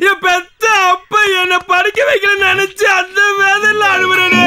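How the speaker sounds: sobbing. Acted. Illiterate man blaming his father, comic sobbing. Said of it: "தேம்பி தேம்பி அழுகுற".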